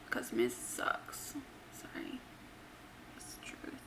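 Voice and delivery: very soft, whispered dig; hushed aside. Real, not acted. Host sneaking a dig at a wrestler, whispered aside. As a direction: slip it in under your breath.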